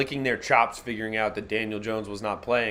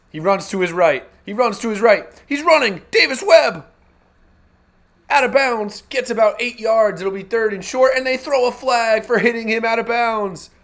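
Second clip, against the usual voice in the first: rising, louder call as he scrambles; building play-by-play; 11 semitones higher, 7 dB louder than usual. Real, not acted. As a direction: build the call from eager to shouting. Live play-by-play building excitement.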